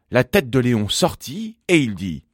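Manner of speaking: plain reading voice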